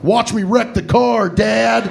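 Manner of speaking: hammed-up drunk driver boast; drunk bravado yell. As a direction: shout it like a reckless kid. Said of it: "you say shit like".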